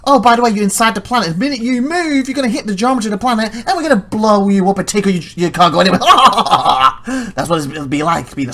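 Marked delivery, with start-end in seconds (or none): 0.0-6.0 high, hurried mock-villain gloating; teasing threat building to a laugh; taunting game. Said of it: "the game was teasing you". 6.0-7.3 loud gloating cackle; big drawn-out taunting laugh; mock villain laugh. Game gloats over destroyed cargo.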